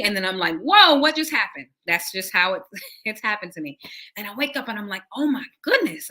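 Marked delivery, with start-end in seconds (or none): 0.6-1.7 loud, high startled cry; startled whoa. Retold or imitated. Waking up startled after vision. 5.2-6.1 amazed. Retold or imitated. Waking up amazed.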